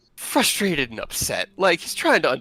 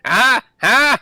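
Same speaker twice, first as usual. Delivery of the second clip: higher, louder demanding snarl, rising each huh; indignant satyr; 10 semitones higher, 13 dB louder than usual. Acted. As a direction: press them to pay for the table.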